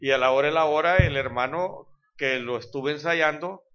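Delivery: usual speaking voice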